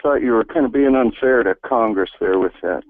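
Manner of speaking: usual speaking voice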